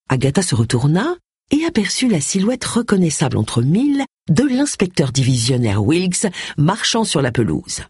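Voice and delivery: ordinary delivery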